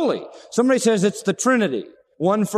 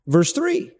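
usual speaking voice

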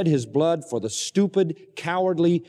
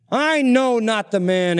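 slow, loud, higher defiant denial; Peter's denial; 7 semitones higher, 4 dB louder, slower than usual. Acted. Peter denying Jesus, slow and loud.

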